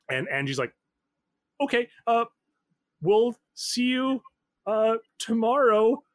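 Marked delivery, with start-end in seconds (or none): 1.6-6.0 high, slow, louder call into a phone; mild mock yell, drawn out. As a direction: call out haltingly from away from the receiver. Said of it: "he's just yelling at the phone".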